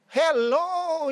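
loud, high, drawn-out hello; acted-out expectant welcome, ringing high; eager greeting. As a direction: call out joyfully to someone at the door. Mother answers long-awaited knock at door.

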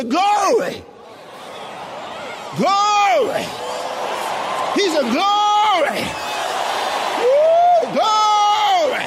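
high, loud whooping shouts; noisy praise. Retold or imitated. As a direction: shout glory like a noisy worshipper. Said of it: "That man was a noisy fellow".

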